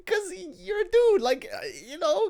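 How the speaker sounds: very high, pleading comeback; comic high-voiced self-defence; defensive squeal. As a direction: squeak out a flustered excuse. Acts out defensive squeaky reply.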